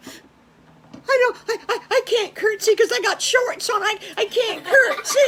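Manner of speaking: high, loud comic wail; hammed-up tearful squeal; wailing distress. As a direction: stammer and wail in a tizzy. Pig upset she cannot curtsy.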